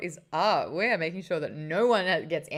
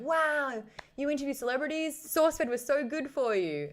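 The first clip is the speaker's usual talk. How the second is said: higher imitation of impressed fans; mock amazement; 7 semitones higher than usual. People admiring her celebrity interviews, mock amazement. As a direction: gush lightly in someone else's voice.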